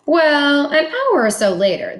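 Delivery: ordinary delivery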